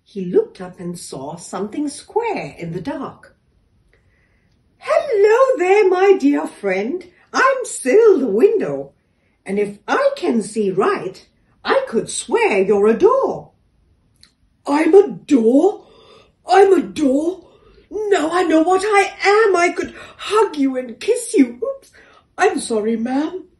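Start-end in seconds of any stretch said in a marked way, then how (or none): 4.8-13.5 friendly window. A narrator's reading. Window greets the door. 14.6-23.5 overjoyed door. A narrator's reading. Door discovers what he is.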